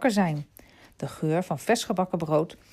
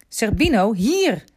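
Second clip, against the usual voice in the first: higher, more insistent loud call; boy calling his dog a second time, more insistent; calling again; 5 semitones higher, 8 dB louder than usual. A narrator's reading. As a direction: call him back once more, sharper. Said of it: "riep Remy weer".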